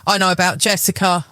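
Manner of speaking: plain reading voice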